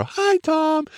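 very high squeaky mock greeting. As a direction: squeak his name high to tease him. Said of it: "to make fun of me".

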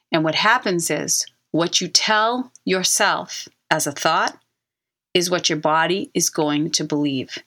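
ordinary talk

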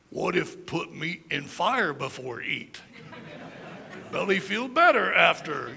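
slow, broken-grammar caveman, laughing through it. Retold or imitated. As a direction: ham up a primitive grunting caveman. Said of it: "some caveman at one point said".

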